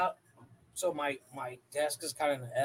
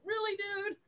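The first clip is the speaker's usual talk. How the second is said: high incredulous "really"; mock disbelief. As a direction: sound baffled and unimpressed.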